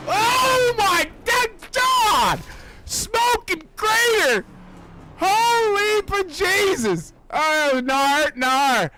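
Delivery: real amazed yelling; stunned excited shout. Real, not acted. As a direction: bellow it out in shock. Huge crash, stunned commentator.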